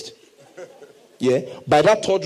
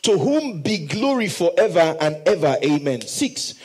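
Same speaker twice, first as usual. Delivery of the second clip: ordinary delivery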